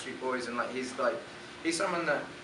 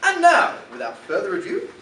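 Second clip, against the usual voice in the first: put-on grand English-accented announcer, louder and higher; dramatic English accent; 6 semitones higher, 10 dB louder than usual. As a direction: launch it theatrically in an English accent. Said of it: "Pretty English, right?".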